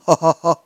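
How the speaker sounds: loud acted burst of laughter; hearty ho-ho laugh. A narrator's reading. Farmer laughs at his wife's answer, bursting out. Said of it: "Der Bauer Antonius lachte auf".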